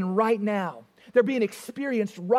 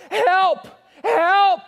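high, loud drowning yell for help; cry for help; 8 semitones higher, 6 dB louder than usual. Retold or imitated. Preacher acting a drowning swimmer, yelling help. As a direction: shout like someone going under the water. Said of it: "they yell out".

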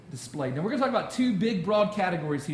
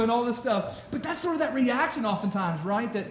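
ordinary talk, nothing marked

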